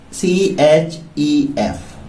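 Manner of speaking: letters drawn out slowly; teacher slowly spelling a word. Shown as a demonstration. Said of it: "spelling wise".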